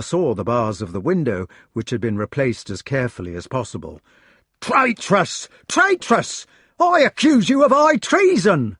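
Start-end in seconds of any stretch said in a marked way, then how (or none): 4.6-5.5 high yell of Traitress!; jealous yell; yelled accusation. A narrator's reading. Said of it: "The yells of Sir Meleagrance". 5.7-8.8 far higher, louder second Traitress!, then accusation; frantic accusing yell; furious yell. A narrator's reading. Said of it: "The yells of Sir Meleagrance".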